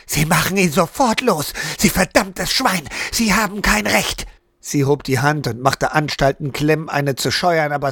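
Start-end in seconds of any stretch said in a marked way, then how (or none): 0.1-4.3 higher pitched angry outburst at the captain; furious. A narrator's reading. Wife storming at the guard who tied her husband, angry. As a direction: lash out at him, fists clenched. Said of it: "Zornig blickte sie zu Clem".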